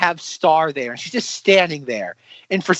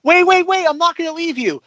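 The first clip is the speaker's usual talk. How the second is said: mock high-pitched pleading; mock plea; 11 semitones higher than usual. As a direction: squeal it like a scene parody. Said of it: "where he's like".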